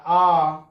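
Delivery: आ held long and loud; long vowel. Shown as a demonstration. Teacher showing that a long vowel takes double time, आ held long. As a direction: draw आ out to double length in a loud steady voice. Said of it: "बोलने से ही पता चलता है".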